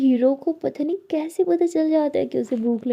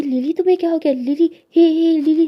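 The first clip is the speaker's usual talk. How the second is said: calling out; 4 semitones higher, 6 dB louder than usual. A narrator's reading. Lakshmi calls to raging Lily.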